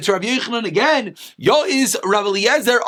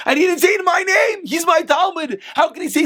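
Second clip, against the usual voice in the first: indignant shout; 7 semitones higher than usual. Acted. Rabbi Yochanan protesting his student's slight, shouting. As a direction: shout it like a wounded teacher. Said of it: "explaining again why he's upset".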